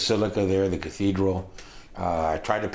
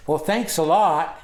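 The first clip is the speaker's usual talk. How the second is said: high, louder, drawn-out mock thanks; mock brush-off; 9 semitones higher, 5 dB louder than usual. Girl to the teller as she walks away, mock brush-off. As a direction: imitate her pointed, stretched-out thanks.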